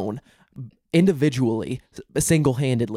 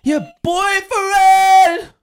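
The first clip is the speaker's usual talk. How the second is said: much higher, much louder, drawn-out squeal; shrieking surprise; 10 dB louder, much slower than usual. Real, not acted. Host squeals at news of her boyfriend, high and loud. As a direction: squeal it in delighted shock.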